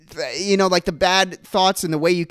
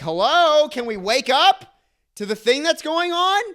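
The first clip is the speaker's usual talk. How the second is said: high, rising incredulous call; exasperated appeal; 10 semitones higher than usual. Acted. Twilight Zone character trying to wake people up, exasperated. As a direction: plead for everyone to notice, pitch climbing.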